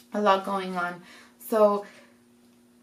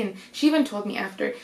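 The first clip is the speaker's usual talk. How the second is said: usual speaking voice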